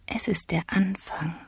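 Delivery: very soft, whispery reply; hushed, delicate character voice; gentle empress. A narrator's reading. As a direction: breathe the answer gently. Childlike Empress answers in soft voice.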